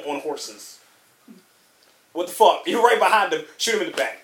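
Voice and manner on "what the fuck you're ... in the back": loud exasperated rant. Real, not acted. Ranting at the movie.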